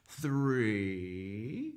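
drawn-out count, sliding upward; long THREE inviting children to join; slow counting. Pretend. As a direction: stretch THREE out, rising at the end.

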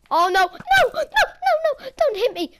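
high, loud frantic cries; panicked shouting. Real, not acted. Player begging an attacker to back off, panicked. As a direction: yell in alarm at an attacker.

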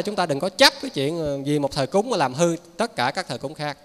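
normal talking voice

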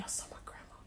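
barely audible whisper; awed whisper. Retold or imitated. Someone back from meeting Jesus tells of grandma, whispered. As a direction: whisper it like a sacred secret. Retold testimony of seeing grandma.

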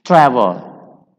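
"Travel" punched on the first syllable, falling away; stress on first syllable. Shown as a demonstration. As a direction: hit TRA in "Travel". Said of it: "The stress is on the first syllable".

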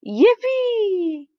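high, long whoop of joy; gleeful yell. A narrator's reading. Everybody cheering as the snowballs fly, gleeful yell. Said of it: "everybody yelled".

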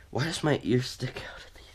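whispered aside; breathy hushed question. Real, not acted. Unvoiced aside.

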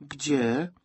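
lightly voiced questioning girl. A narrator's reading. Girl asks where the keepsakes went, questioning. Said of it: "spytała dziewczynka".

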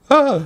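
sharp, very high yelp of fright; startled scream. Real, not acted. Gamer jolted by a sudden scare, screaming. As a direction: let out a short scared scream.

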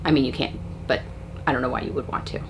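normal talking voice

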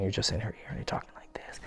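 hushed whisper close to the ear; very soft, intimate whisper; sexy whisper. Shown as a demonstration. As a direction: whisper it intimately, just for one listener. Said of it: "you're talking in a sexy voice".

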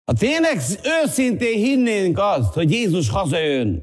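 high opening, settling as it goes; fervent appeal from the pulpit; impassioned preaching. Real, not acted. Preaches at full force.

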